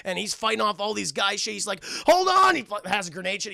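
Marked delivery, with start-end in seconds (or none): 2.1-2.5 high, loud combat yell; urgent shout. Acted. Mister Cunningham fighting off attackers, high urgent shout. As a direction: yell it mid-battle, pitched right up. Acting soldier with grenade.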